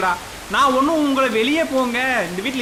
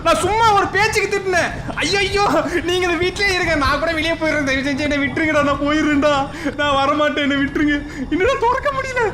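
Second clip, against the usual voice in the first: high-pitched, frantic pleading; panicked begging; 5 semitones higher than usual. Real, not acted. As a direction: beg to be let go in a rising panic. Begging ghosts, trapped at the door. (பயத்தில் கதறிக் கெஞ்சும் குரல்)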